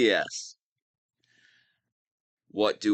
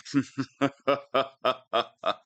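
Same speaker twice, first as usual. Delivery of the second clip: villain laugh; 2 semitones higher than usual. Acted. Villain gloating over captured sparrow.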